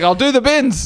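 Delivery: put-on high eager old-man voice; cheerful, fussy offer to help; old neighbour Jeff. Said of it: "He's like".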